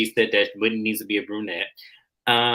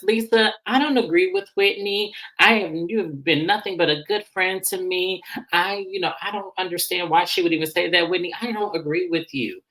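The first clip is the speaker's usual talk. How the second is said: lightly acted, higher-pitched protest; retold earnest protest; 7 semitones higher than usual. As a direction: voice a friend earnestly chiming in. Retold Brittany defending her friend.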